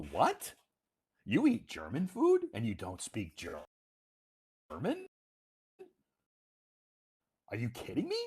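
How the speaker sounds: acted incredulity, pitch leaping up on the questions; mock disbelief. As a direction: throw his logic back in feigned astonishment. Teasing comeback with mock disbelief.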